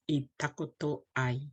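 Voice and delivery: normal talking voice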